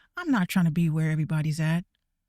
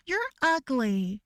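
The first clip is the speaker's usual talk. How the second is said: a lightly voiced bully, higher and slow; mock video bully; 6 semitones higher, slower than usual. Streamer mocks a Dhar Mann bully, lightly voiced and slow. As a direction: mimic a cheesy video bully line. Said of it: "Dhar Mann videos".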